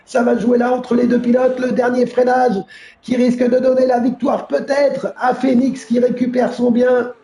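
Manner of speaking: lively live play-by-play; motorsport commentator. Real, not acted. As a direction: call the race as it happens. (commentateur de course automobile)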